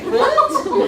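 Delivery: high, loud shocked squawk. Retold or imitated. Stunned daughter reacting to her mom, high and loud. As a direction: yelp in disbelief.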